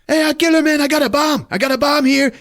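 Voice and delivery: high, loud ranting thug voice; mock villain yell. Gunman yelling at hostages, high mock shout. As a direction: screech it like a crazed gunman.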